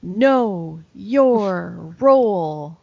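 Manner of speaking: slow, booming wrestler growl; wrestling promo voice. Shown as a demonstration. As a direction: drag it out like The Rock on the mic. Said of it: "My wrestling voice".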